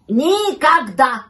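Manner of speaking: slow, loud, drawn-out verdict; emphatic, forbidding refusal; scolding dog. A narrator's reading. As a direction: stretch it out as a stern last word. Dog's final scolding verdict.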